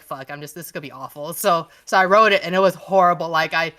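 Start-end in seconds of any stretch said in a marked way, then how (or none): 0.0-1.3 much softer, muttered despair; quiet dread. Retold or imitated. Author retelling choosing a character to kill, quiet dread. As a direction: say it under your breath, dreading it.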